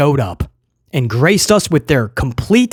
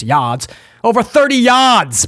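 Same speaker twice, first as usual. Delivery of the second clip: put-on playful Boston accent, R dropped in "yards"; 3 semitones higher than usual. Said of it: "I don't know where that accent came from".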